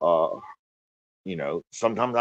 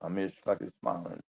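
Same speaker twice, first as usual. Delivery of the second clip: quiet and sad; 3 semitones lower, 10 dB quieter than usual. Real, not acted. Depressed confession on stream.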